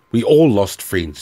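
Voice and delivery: loud, slow, bitter snap; a soldier snapping back at a comrade; snapped. Acted. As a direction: bite the words off in anger. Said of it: "snapped Jonat".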